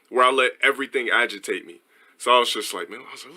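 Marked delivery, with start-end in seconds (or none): none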